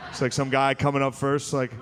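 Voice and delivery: ordinary delivery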